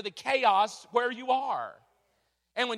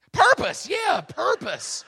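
much higher and louder, Purpose shouted; excited shout; 9 semitones higher, 10 dB louder, slower than usual. Retold or imitated. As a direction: explode on the word with preacher zeal. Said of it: "that's what he does. That's him".

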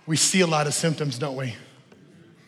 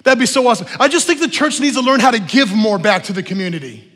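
eager churchgoer; 5 semitones higher, 6 dB louder than usual. Retold or imitated. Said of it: "A symptom might sound like this".

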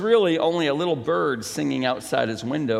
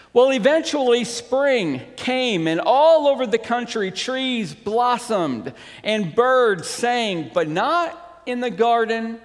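higher, animated storybook reading; story narration; 7 semitones higher, 5 dB louder than usual. A narrator's reading. Pastor reading The Selfish Giant to the congregation, lifted story narration. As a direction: read it out with lifted, emphatic storyteller energy.

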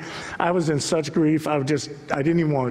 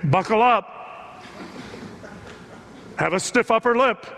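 raised, drawn-out mock command; mock stern rebuke; 6 semitones higher, slower than usual. Preacher voices what God doesn't say to lamenting Israel, mock stern. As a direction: imitate a God who scolds instead of comforting. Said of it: "God doesn't show up and say".

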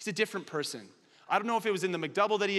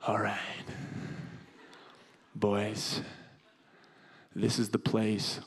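a hint of a lower, slow mock-commando voice; lightly voiced commando; 10 semitones lower, 4 dB quieter, slower than usual. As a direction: play it like a mock squad leader, slowly. [name] playing commando at house.

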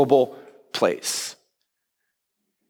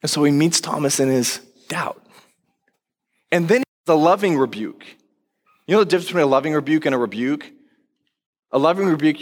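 usual speaking voice

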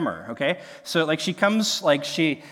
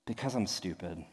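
much softer, lower, deflated mumble; total resignation; 8 semitones lower, 12 dB quieter than usual. Retold or imitated. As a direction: give up completely, head hanging. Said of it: "Just, just total resignation".

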